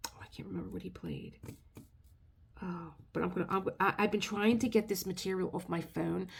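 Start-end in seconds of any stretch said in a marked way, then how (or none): 0.1-2.9 breathy unvoiced whisper, much softer; whispered aside while holding back tears. Real, not acted. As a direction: let it drop to a whisper, overcome.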